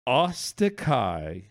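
very slow, low, measured pronunciation. Shown as a demonstration. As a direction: draw the name out, pausing in the middle. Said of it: "Say it slowly".

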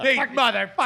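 high, hammed-up furious ranting; pissed off. Storyteller obliging friend who pictures him furious, hammed-up rage. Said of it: "and I am fucking pissed".

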